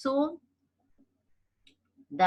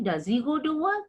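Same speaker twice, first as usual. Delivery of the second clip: "work" rises at the end; question tune; 6 semitones higher than usual. Shown as a demonstration. Teacher showing students a question's rising tune. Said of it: "you can see the question mark".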